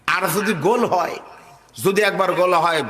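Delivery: ordinary talk, nothing marked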